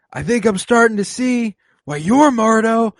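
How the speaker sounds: high, loud put-on voice, slowing down; film character impression. Quoting the line about Mordo, loud high impression. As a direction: push it high and drag out the ending.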